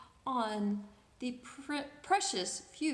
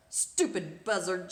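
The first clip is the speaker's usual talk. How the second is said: lower, contemptuous sneer at the bird; scornful; 7 semitones lower, 3 dB louder than usual. A narrator's reading. Girl sneering at the useless parrot, scornful and lower. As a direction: spit it out with disdain.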